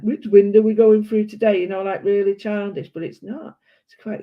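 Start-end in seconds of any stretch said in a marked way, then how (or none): 0.0-1.6 put-on louder, sing-song children's presenter voice; a light take on a cheery kids' show host. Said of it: "play school with that".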